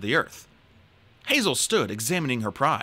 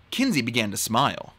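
ordinary delivery, nothing marked

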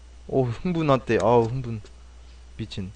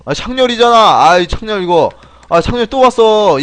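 louder, higher-pitched groaning protest; exasperated complaint; 7 semitones higher, 8 dB louder than usual. Real, not acted. Streamer sees the rip-off has come again, exasperated. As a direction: complain loudly in raised pitch.

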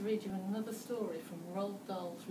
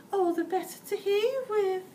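high, loud put-on granny voice; the wolf faking Grandma's voice; wolf in Grandma disguise; 14 semitones higher, 8 dB louder than usual. A narrator's reading. Wolf answers Red in a high false Grandma voice. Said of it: "the wolf replied".

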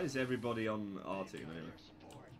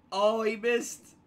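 loud dismay; 12 semitones higher, 13 dB louder, much slower than usual. Real, not acted.

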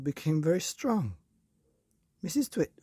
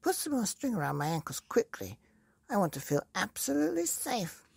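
put-on high, bossy old woman's voice; Mrs Twit demanding; 8 semitones higher, faster than usual. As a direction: order him about nervously. Mrs Twit demands more string.

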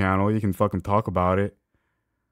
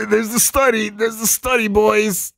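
much higher, louder sarcastic cheer; mock excitement; 13 semitones higher, 5 dB louder than usual. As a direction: fake delight at finding the study. Mocking excitement at the study.

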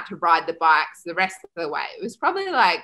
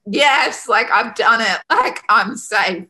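louder, lifted retold cheer; triumphant relief; 3 semitones higher, 5 dB louder, slower than usual. Swimmer realises she is safe after her leg, triumphant. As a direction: burst out on YES, relieved.

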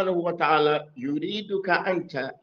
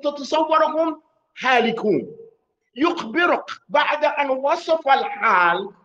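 higher, louder, pressing "أنت" at the listeners; emphatic address; 11 semitones higher, 6 dB louder than usual. Real, not acted. Teacher driving home the pronoun to students, rising intensity. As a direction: urge the listener that this is meant for you. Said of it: "emphasizing you directed at listener".

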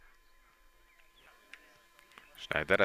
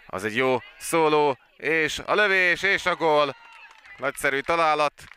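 excited hungarian sports commentary; 5 semitones higher, 4 dB louder than usual. Real, not acted. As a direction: lift the voice as the shot goes in. Live goal commentary, goal scored.

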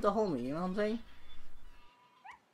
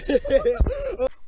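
burst of laughter; 8 semitones higher, 7 dB louder than usual. Real, not acted. Laughing at game start.

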